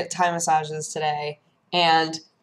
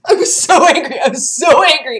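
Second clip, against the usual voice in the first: higher, louder, laughing through it; laughing exasperation; 7 semitones higher, 8 dB louder than usual. Real, not acted. Retelling the forced concede, laughing exasperation. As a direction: laugh off the rage.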